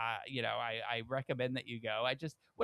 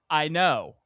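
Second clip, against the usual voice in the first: curt waiter; 9 dB louder than usual. Retold or imitated. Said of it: "And the guy goes".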